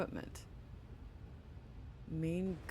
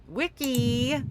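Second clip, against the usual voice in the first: excited; 8 semitones higher, 9 dB louder, slower than usual. Real, not acted. Excited at a menu item.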